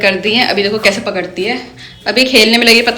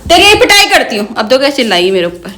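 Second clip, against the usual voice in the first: raised, sharp scolding of a pet parrot; 7 semitones higher than usual. Real, not acted. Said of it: "जब इसे डांट के बुलाती हूँ".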